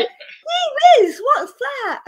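hammed-up innocent astonishment; mock polite surprise. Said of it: "You're not gonna go".

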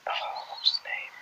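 whispered to self; breathy murmur trying to remember. Real, not acted. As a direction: whisper while searching memory. Trying to recall a name.

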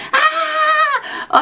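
high, far-off shriek of terror; hammed-up group scream; mock scream. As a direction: scream like everyone in the haunted house. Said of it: "y todos así".

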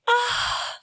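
high, swooning sigh of emotion; teary-eyed high swoon. Retold or imitated. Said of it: "made me teary-eyed".

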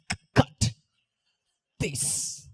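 "cut" clicked like a snare, "This" an unvoiced hiss; beatbox pronunciation. Shown as a demonstration. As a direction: fire off "cut" and "This" without voice as drum sounds. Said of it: "say it like this".

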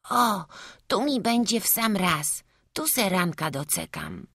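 lightly voiced high boy; a hint of a pleased little boy; content small boy. A narrator's reading. Boy settled in the tree, lightly voiced high.

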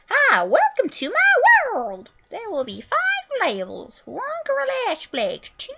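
lightly voiced game host reading the levels; put-on announcer voice.

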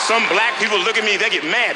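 ordinary talk, nothing marked

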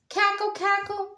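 high cackling hen sounds; clucking hen. A narrator's reading. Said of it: "she clucked and laughed".

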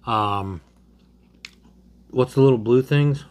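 talking while eating cracker; eating a crunchy snack; mouth full, chewing. Real, not acted. Seen 1: Bearded man holds cracker at mouth, bites and eats while naming.

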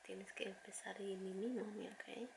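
soft unvoiced whisper. Real, not acted. Mother whispering school advice to her daughter, soft whisper.

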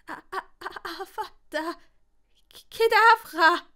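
high stuttered start bursting loud; stammering. A narrator's reading. Longbottom failing the curse at the spider, stammering. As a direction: stammer the first syllable, then shriek the rest. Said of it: "stotterte der Gryffindor".

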